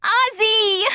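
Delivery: very high desperate cry. A narrator's reading. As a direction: reach for him with a call. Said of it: "Emily cried".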